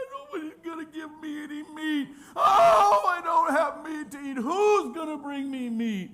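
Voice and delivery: loud, high mock bawling; crying like babies. Israelites crying at their tent doors, hammed-up baby wail. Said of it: "they cry like babies".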